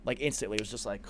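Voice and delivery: ordinary talk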